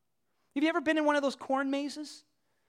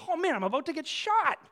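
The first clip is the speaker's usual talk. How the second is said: mock dismayed bear; 6 semitones higher than usual. Voices bear facing the gun.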